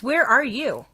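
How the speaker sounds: normal talking voice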